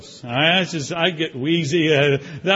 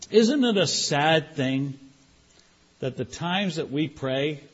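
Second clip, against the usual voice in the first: ordinary talk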